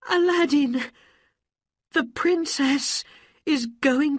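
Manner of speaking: high, loud, breathless alarm; frantic mother. Acted. Mother rushing home from market to tell Aladdin, frantic and loud. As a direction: burst in with terrible news.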